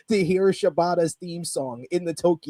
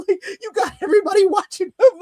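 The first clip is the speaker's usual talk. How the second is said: high, fast, squealing laugh through the words; real laugh, high and quick; 5 dB louder, faster than usual. Real, not acted. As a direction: crack up while you say it. Laughing at the surprise entrance.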